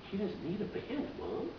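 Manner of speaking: wondering, high-pitched aside to a parent; child to mom. Retold or imitated. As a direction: imitate an amazed little boy. Childhood self amazed, talking to mother.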